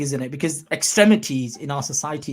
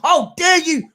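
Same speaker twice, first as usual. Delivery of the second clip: a hint of mock indignation, pitched up; mock outrage; 10 semitones higher, slower than usual. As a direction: lightly parody the outraged accuser, half laughing. Mocking people who blame Muslims.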